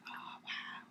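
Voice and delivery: breathless little whisper of disbelief; stunned whisper. Retold or imitated. To the nurse in intensive care, a stunned whisper. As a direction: barely voice it, dazed and amazed.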